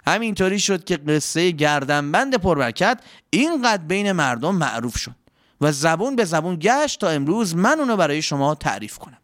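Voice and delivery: ordinary delivery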